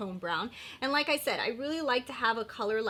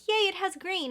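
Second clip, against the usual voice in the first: higher, bright mock cheer; mock excited; 8 semitones higher than usual. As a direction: squeal like you just found green in a palette. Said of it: "I'm like".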